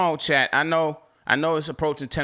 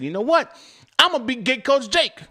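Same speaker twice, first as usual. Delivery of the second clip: higher and louder, hyped declaration; excited vow; 9 semitones higher, 4 dB louder than usual. Retold or imitated. To chat, retelling his vow to coach drivers, excited. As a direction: announce it like a big decision.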